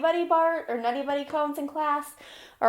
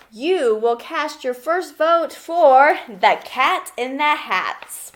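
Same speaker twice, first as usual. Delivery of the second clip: louder, slow grand book ending; big finale plea; 4 semitones higher, 8 dB louder, slower than usual. Acted. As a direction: land the last line slowly and loud. Book's big closing plea.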